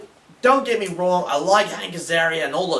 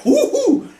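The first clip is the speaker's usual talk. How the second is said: high, loud woo-hoo; excited whoop; 8 dB louder than usual. Acted. Reviewer whooping over how wild museums are, excited whoop. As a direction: whoop it out with a laugh.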